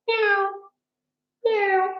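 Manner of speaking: high, soft little meows; cat meow. Shown as a demonstration. Teacher showing kids how a cat sounds, soft high meow. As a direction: meow like a gentle kitten for the children. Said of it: "you can make it sound like a cat".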